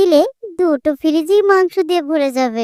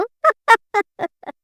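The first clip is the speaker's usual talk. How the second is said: teasing laugh; 4 semitones higher than usual. Acted. Wife teasing husband.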